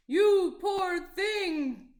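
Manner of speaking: big, slow, loud boom; booming giantess. Acted. Giantess booming pity at hungry little Jack. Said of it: "boomed the giantess".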